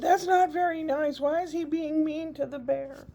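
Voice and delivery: put-on high, loud little boy's complaint; imitating grandson upset for the bear; child's protest. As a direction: voice a hurt small child scolding.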